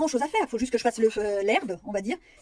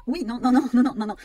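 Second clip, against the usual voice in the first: louder rapid string of non; insistent, louder refusal; emphatic no; 6 dB louder than usual. Real, not acted. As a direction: firmly shut the idea down. Rejects the imagined praise.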